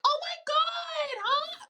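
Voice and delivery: very high, drawn-out fake squeal; over-excited social greeting, stretched out; talking up high. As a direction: gush way up in a put-on register. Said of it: "talk up here".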